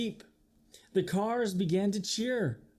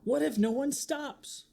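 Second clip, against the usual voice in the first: higher, anxious question; a frightened truck asks, higher and anxious; worried high voice; 9 semitones higher than usual. Acted. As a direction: fret like a scared little truck. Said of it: "Trucker trembled".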